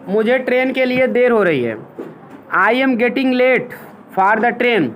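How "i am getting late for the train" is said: each word spaced out slowly for the class; slow dictation. Shown as a demonstration. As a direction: read it out slowly so they can write. (धीमी, रुक-रुक कर लिखवाना)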